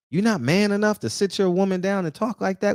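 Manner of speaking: ordinary talk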